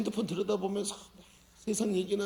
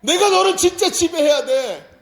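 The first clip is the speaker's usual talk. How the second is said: high, slow, shouted insistence; Jesus insisting; 10 semitones higher, 10 dB louder, slower than usual. Pretend. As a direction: proclaim it as Jesus, loud and slow. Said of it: "예수님이 뭐라고 해요?". (예수님처럼 높고 느리게 외치듯)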